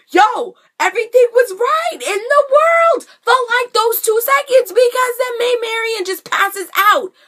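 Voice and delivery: high, loud outburst; worked-up exasperation. Real, not acted. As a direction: wail it in dramatic protest at the injustice. Exasperated at the plot twist.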